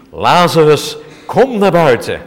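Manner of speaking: loud commanding call into the tomb; Jesus calling. Acted. Preacher voicing Jesus at the grave, loud command. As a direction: call the dead man out with full force. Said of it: "En Jezus riep".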